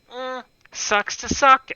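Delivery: high, slow taunting sister voice; imitating the sister's smug brush-off; mocking sister. Teasing older sister dismissing the injury, mock smug. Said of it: "she's already going like".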